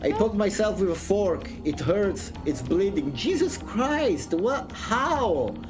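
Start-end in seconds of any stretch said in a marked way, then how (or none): none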